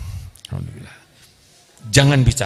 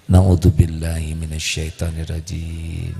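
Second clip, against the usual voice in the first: mock pious husband; 9 semitones lower than usual. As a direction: intone it low like a mock holy man. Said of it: "kalau A, saya".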